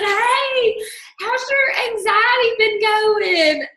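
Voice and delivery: high, drawn-out sing-song; sing-song sweet talk. Retold or imitated. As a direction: sweetly sing it out like a sales pitch. Sweet-talking mom on phone.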